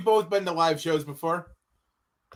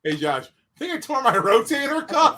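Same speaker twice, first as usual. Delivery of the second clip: laughing mock-injured whine, pitched up; joking complaint; 3 semitones higher, 5 dB louder than usual. Telling Josh about a torn rotator cuff, laughing complaint. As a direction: play up the sore shoulder through laughter.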